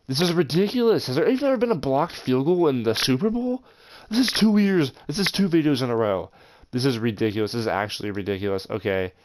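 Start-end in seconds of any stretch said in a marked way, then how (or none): 0.1-6.3 exasperated, pitch jumping much higher; venting real anger at the game; frustrated outburst. Real, not acted. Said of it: "I'm just mad".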